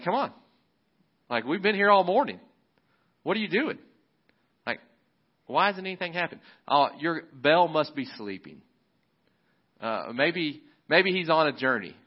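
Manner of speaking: high, mocking taunt as Elijah; trash talk. Elijah taunting the prophets of Baal, high mocking jeer. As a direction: needle them like a cocky instigator. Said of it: "He's talking trash".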